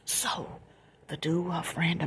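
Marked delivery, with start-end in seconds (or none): 0.0-0.6 soft late-night whisper; quiet at night. Real, not acted. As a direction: whisper so nobody wakes up. Late-night storytime, hushed.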